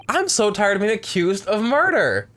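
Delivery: high, exasperated protest. Real, not acted.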